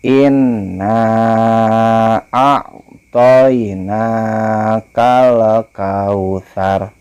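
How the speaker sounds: slow, melodic chant with long vowels; Quran recitation. Shown as a demonstration. Teacher models the verse for pupils, slow chant. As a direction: recite slowly, stretching each vowel. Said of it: "jadi harus dibaca panjang ya pas innanya".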